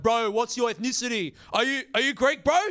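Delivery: high, eager mock Greek bro; excited Greek dude. Excited mock bro voice. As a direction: Greek dude eagerly quizzing the comic about his ethnicity. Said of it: "Greek dudes coming up to me excited".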